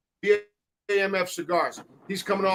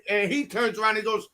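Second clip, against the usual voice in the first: normal talking voice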